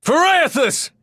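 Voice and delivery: loud, very high shouted invocation; battle cry. Acted. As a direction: roar the name charging into a fight.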